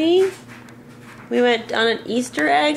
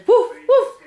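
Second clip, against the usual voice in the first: high, loud playful woof; dog woof; 12 semitones higher, 5 dB louder than usual. Pretend. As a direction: bark like a puppy for a toddler. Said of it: "Doggy says".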